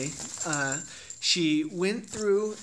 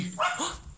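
excited gasp; 12 semitones higher, 4 dB quieter than usual. Real, not acted.

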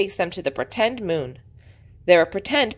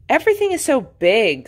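bold, higher big voice; storybook big voice, slightly louder; 6 semitones higher, 4 dB louder than usual. A narrator's reading. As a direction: say it big and emphatic. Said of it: "in a very big voice".

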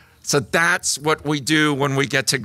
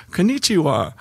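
mock Japanese greeting; 5 semitones higher than usual. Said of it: "my wife looks at him and says".

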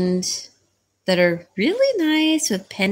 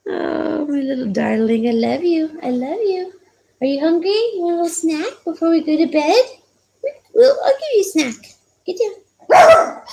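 doting pet talk; 7 semitones higher than usual. Real, not acted. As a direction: coo lovingly at a little puppy. Cooing to the puppy.

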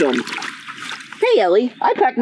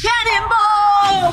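long drawn-out shout; cannonball yell; 6 semitones higher, much slower than usual. Real, not acted. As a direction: yell it slow as you jump. Jumping into pool shouting.

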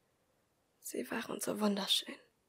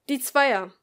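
louder, higher roll call; 4 semitones higher, 10 dB louder than usual. Acted. Teacher calls the next number to the class, loudly. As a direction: announce to the room.

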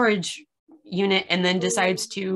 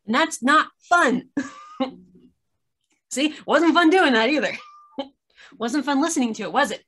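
annoyed, higher-pitched rant broken by chuckles; mild exasperated anger; 5 semitones higher than usual. Real, not acted. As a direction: vent with a half-laughing edge of anger. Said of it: "some anger there".

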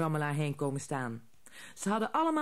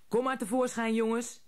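calling out; 6 semitones higher than usual. A narrator's reading. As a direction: call them out of hiding. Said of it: "riep hij".

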